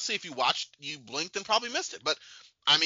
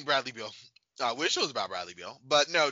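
ordinary talk

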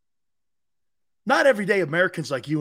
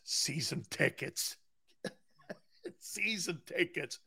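very soft, slow delivery; slow, hushed aside to yourself; hushed repetition; 12 dB quieter, slower than usual. Acted. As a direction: drop right down and linger on each word. Savoring the idea of tickets.

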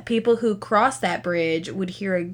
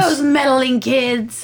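Scooby-Doo villain; 5 semitones higher, 5 dB louder than usual. Retold or imitated.

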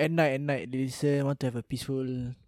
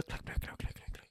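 whispered clap sounds; 15 dB quieter than usual. Pretend. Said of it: "you can do our own applause here".

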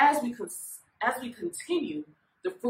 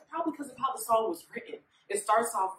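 usual speaking voice

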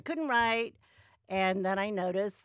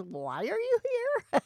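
puzzled doctor; 13 semitones higher, slower than usual. Retold or imitated. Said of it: "He goes".

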